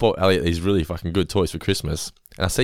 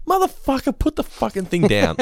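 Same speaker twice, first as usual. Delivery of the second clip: much higher, slow, outraged command; mock furious yell; 13 semitones higher than usual. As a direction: roar at the kid with the toy. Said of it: "And I'm like".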